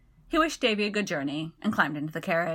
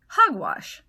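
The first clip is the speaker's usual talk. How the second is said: a sharp, louder Hogwash, voice jumping up; exclaimed; 6 semitones higher, 5 dB louder than usual. A narrator's reading. Segundus scoffing at Childermas, loud exclamation. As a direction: burst out in protest. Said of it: "Segundus exclaimed".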